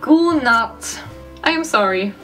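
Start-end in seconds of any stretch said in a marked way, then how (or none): none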